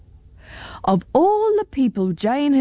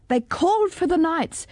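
ordinary delivery

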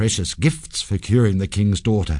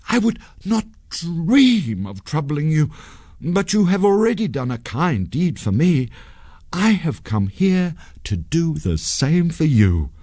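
high, earnest character voice; ingratiating young man, pitched up; placating hero; 9 semitones higher than usual. A narrator's reading. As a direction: plead friendship to fool a ghost. Sanjay placates ghost with a ruse.

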